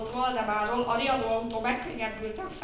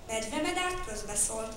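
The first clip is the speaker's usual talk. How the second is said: ordinary delivery, nothing marked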